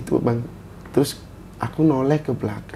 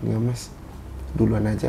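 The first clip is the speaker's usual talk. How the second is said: soft, lowered retold answer; imitated quiet reply; 4 semitones lower, 7 dB quieter than usual. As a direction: keep it faint and indistinct. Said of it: "kayak pelan gitu". (menirukan suara pelan samar-samar)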